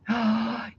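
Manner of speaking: a sharp breathy gasp of alarm; quick whispered intake of breath. Real, not acted. Reacting to police robot dogs.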